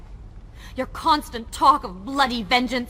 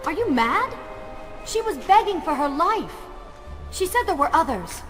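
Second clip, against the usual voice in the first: higher, raised voice in disbelief; indignant protest; 4 semitones higher than usual. Acted. Woman challenges prince over abandoning those begging for help, indignant. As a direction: protest with appalled urgency.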